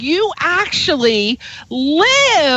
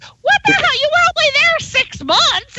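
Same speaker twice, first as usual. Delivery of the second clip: high-pitched imitation of baffled friends; mock incredulity; 12 semitones higher than usual. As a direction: squeal it in playful disbelief. Acted reaction of people to her accent.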